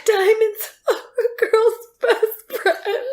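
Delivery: high, slow laughing gush; mock swooning wife. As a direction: coo it like a dazzled trophy wife. Character voiced gushing.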